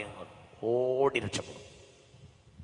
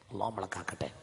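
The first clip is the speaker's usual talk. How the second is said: softly murmured blessing; hushed prayer before the call; 4 semitones lower, 12 dB quieter, faster than usual. Real, not acted. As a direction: say it low under the breath. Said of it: "ഉറക്കെ പറ".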